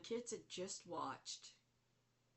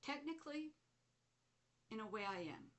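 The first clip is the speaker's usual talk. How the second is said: Grandmother Spider; 6 semitones higher than usual. Retold or imitated. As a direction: put on a lilting grandmotherly character voice. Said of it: "She goes".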